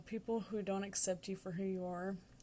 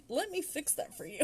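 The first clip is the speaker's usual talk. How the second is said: higher, sassier eighties schoolgirl voice; 7 semitones higher than usual. Retold or imitated. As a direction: mimic a pushy classmate, pitched up. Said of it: "so it was more like".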